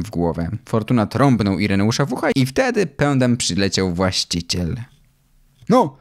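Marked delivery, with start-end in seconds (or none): 5.7-6.0 calling out. A narrator's reading. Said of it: "zawołał do mojego tatę".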